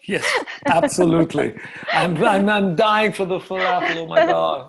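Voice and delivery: high, loud laughing reply. Real, not acted. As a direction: laugh through the words. Laughing farewell banter.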